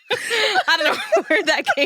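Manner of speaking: laughing loudly, high. Real, not acted. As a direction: burst out laughing mid-sentence. Laughing at own accent.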